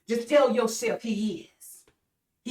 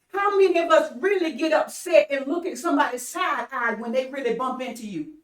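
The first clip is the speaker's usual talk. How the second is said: ordinary delivery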